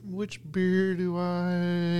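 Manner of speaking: slow, drawn-out indecision; dithering beer choice. Retold or imitated. Mocking himself at the beer taps, slow and dithering. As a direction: stretch it out, lost at the bar. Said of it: "I'm like".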